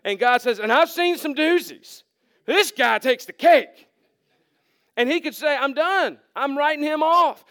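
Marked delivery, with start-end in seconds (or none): 0.6-3.9 louder, higher, hammed-up scorn, the last line slowed; mock exasperated God. God mocking the worst sinner, hammed-up louder scorn. 5.5-7.4 a hint of God writing him off; lightly voiced disgust, a bit higher; mock fed-up God. God giving up on the sinner, mock fed-up.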